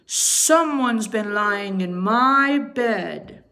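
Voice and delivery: slow, loud papa bear. Acted. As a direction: drag it out like a shocked big bear. Papa Bear discovers his bed.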